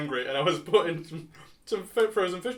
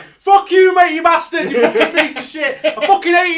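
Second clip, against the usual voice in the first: put-on shouted in a broad Nottingham accent, much higher and louder; angry Nottingham accent; 11 semitones higher, 12 dB louder than usual. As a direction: rage in full Nottingham accent. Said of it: "my accent came back full force".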